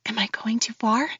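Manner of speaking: plain reading voice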